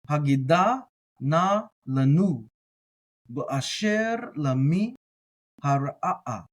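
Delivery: slow, careful Hebrew reading; deliberate, drawn-out learner's read-through; word by word. Shown as a demonstration. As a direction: read the Hebrew one word at a time, with pauses. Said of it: "we went word by word".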